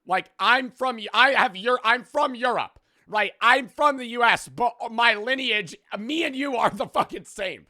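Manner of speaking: loud, high-pitched exasperated rant; worked-up, indignant outburst; getting mad. Real, not acted. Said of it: "I'm gonna start getting mad".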